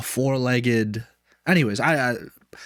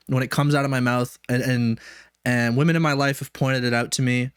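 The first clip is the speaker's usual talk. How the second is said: ordinary talk, nothing marked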